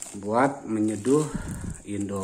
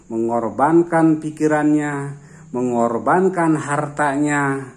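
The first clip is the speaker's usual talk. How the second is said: preaching; 2 semitones higher, 4 dB louder than usual. Acted. Quoting God's promise, preacher-like.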